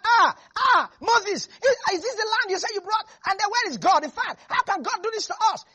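high, loud panicked wail; mock the whining people, high and loud; hammed-up high crying complaint; panicked complaining crowd. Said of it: "everybody was not".

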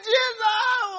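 high, wailing cry; imitated screaming. Preacher acting the woman crying to Jesus, screaming. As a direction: wail it out high and loud. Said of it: "She was screaming".

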